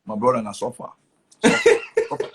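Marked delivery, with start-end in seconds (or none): 1.4-2.3 mock wail. Crying comedian wails the word.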